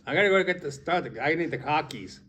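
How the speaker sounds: mock Boston accent, dropped R in "car"; hammed-up Boston local in a hurry. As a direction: say "car keys" as "cah keys", Boston style. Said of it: "like listening to somebody from Boston talk".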